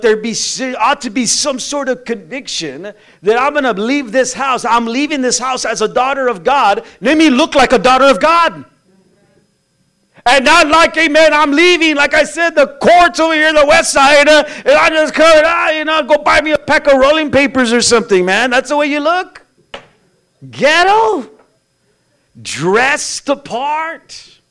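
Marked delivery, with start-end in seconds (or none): 3.2-21.3 loud, high, driving sermon; full-force preaching. Acted. 22.4-24.4 "Dress the part" drawn out very slowly, each word weighted; slow emphatic. Shown as a demonstration.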